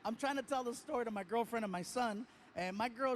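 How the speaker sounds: ordinary talk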